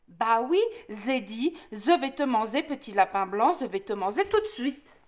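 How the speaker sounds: "je" said as "ze", with a lisp; lisping wolf. Acted. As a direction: lisp the threat like a storybook wolf. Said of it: "t'as un cheveu sur la langue". (grand méchant loup qui zozote)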